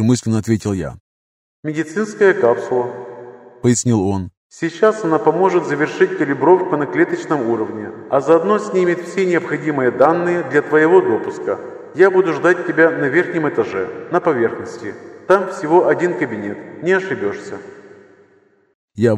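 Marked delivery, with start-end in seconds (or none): 1.6-3.1 voice in the head. A narrator's reading. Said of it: "Голос прозвучал прямо в голове". 4.5-17.6 telepathic voice. A narrator's reading. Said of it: "Голос прозвучал прямо в голове".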